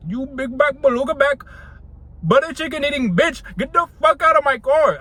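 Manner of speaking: insulting in a mock Indian accent, rising to a yell. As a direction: roast her in a hammed-up Indian accent. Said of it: "turn on my Indian accent".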